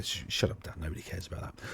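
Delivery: fast self-scolding mutter; muttering to himself. Real, not acted. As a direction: hush yourself up quickly. Cuts off his own tangent.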